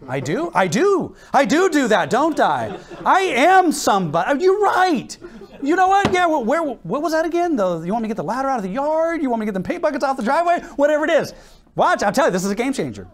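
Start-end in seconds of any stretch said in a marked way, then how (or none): 0.1-0.5 doubtful question. Acted. Flattered husband at first doubtful. 0.5-1.1 louder, pleased agreement; convinced realization. Acted. Flattered husband now convinced. 1.3-11.3 high, puffed-up mock enthusiasm; flattered eagerness. Acts husband won over by praise.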